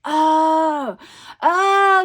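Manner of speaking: loud, high, drawn-out "oh"; retold realization. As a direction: re-enact the penny finally dropping, loudly. Acting out her dawning realization.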